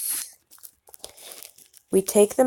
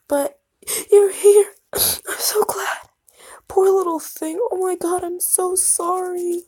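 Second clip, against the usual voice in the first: much higher, sobbing and breaking; crying; 11 semitones higher, 3 dB louder than usual. Acted. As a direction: weep with relief. Tearful reunion.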